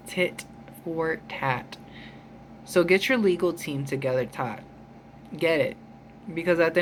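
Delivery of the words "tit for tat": Tit for tat dragged out slowly and softer, each word separate; slow, much softer repeat for emphasis; slow emphatic repeat. Shown as a demonstration.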